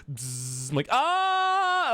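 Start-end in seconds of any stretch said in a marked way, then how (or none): 0.1-0.7 buzzing noise, higher and softer; imitated clipper buzz. Streamer acting out the barber's clippers, imitated buzz. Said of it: "And she just goes". 0.9-1.9 long, high, loud yell; freaked-out scream. Retold or imitated. Streamer retelling a shock haircut, acted freaked-out scream. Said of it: "I'm, like, freaking out".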